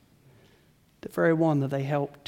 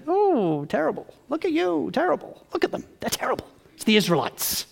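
higher, sing-song scolding; mock self-righteous tutting; 7 semitones higher than usual. A churchgoer pointing at the Israelites, mock self-righteous. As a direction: wag a finger in mock disgust.

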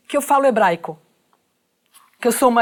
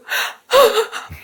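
put-on high teary gasp like a crying child; childlike sob gasp; 12 semitones higher than usual. Reporter breaking down when the officer apologizes, teary gasp. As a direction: catch your breath like a child about to cry. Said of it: "eu tava que nem criança".

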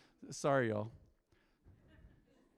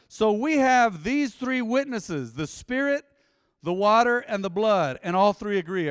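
emphatic scripture reading; 4 semitones higher, 6 dB louder than usual. Acted. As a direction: proclaim the verse phrase by phrase to the congregation. Reads scripture to congregation.

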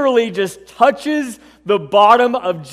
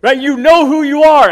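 raised, higher call into the crowd; 7 semitones higher, 6 dB louder than usual. Acted. Jesus calling out to the crowd, loud and high. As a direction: demand the one who touched him to speak up. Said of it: "he calls out".

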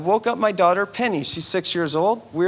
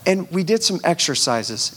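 ordinary talk, nothing marked

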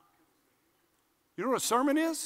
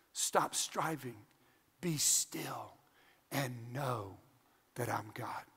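slow, lower, whispered scripture; hushed whisper; 9 semitones lower, 10 dB quieter, much slower than usual. Acted. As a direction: let the verse fall to a whisper of stillness. Quoting scripture on silence.

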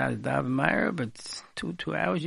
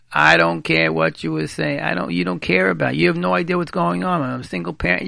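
louder, fed-up wife venting at her husband; mock angry wife; 6 dB louder than usual. Rabbi acts out a tired wife snapping at her husband, loud. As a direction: vent it like the frazzled mom at home. Acted wife yelling back.

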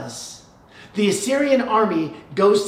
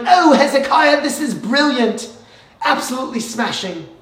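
hammed-up posh British accent, higher and louder; mock British accent; 6 semitones higher, 5 dB louder than usual. Babylonian dignitaries arriving at the gates, mock British accent. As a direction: play fancy visitors in a put-on British accent. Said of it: "I don't have a good British accent".

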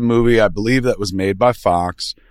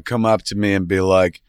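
ordinary talk, nothing marked

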